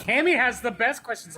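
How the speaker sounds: high, loud mock gushing; mock gushing praise. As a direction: gush it high and loud, teasing. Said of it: "be like".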